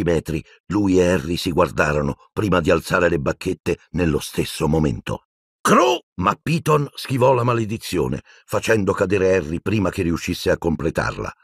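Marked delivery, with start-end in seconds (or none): 5.6-6.0 short, very high yell; shouted spell. A narrator's reading. Harry starting a curse at Piton, shouting. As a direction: burst out with a cut-off incantation.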